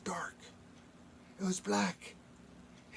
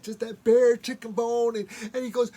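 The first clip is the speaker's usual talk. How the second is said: plain reading voice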